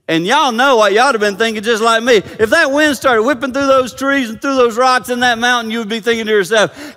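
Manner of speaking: high, loud preacher's urgency; full-force preaching. Real, not acted. Preacher telling congregation that God brings peace, full-force preaching.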